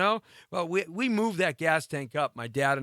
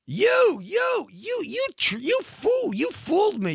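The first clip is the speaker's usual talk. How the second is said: very high, shouted mock accusation; hammed-up hysterical indignation; sputtering outrage; 14 semitones higher, slower than usual. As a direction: stammer and squeal at the one who conned you. Acted outrage at being conned.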